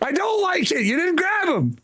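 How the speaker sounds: very high squealed objection; comic protest. Real, not acted. Player objecting to a teammate's missed grab, squealed comic protest.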